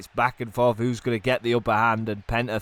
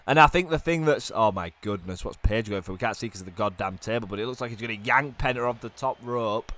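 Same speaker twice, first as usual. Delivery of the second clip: ordinary delivery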